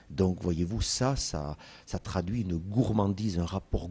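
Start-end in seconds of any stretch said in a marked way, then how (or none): none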